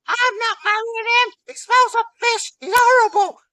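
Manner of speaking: very high, loud shrieking refusal; outraged Freya. Acted. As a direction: shriek it like an appalled goddess. Freya outraged at marriage plan.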